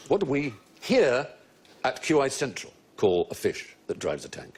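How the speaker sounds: ordinary delivery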